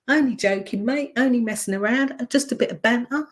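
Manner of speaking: high jeering voice; mocking banter. People ridiculing a man with high mocking banter. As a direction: tease like a lad brushing it off. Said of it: "with people going".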